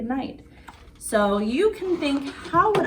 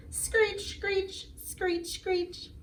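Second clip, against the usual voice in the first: much higher, softer squeaky screeches; bat screech; 10 semitones higher, 8 dB quieter, much slower than usual. Pretend. Vampire kid saying good night, high soft bat screech.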